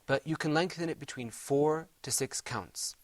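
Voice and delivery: normal talking voice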